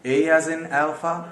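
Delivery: "A as in alpha", each word drawn out slowly; slow spelled-out demo. Shown as a demonstration. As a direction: model "A as in alpha" slowly for learners. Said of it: "फिर चलते हैं".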